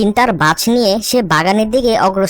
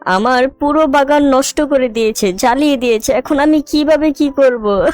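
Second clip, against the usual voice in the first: tearful lament, pitched higher; high, weepy storybook sobbing; acted crying; 4 semitones higher than usual. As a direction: wail in despair over a terrible loss. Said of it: "অজরে কান্না করতে থাকে".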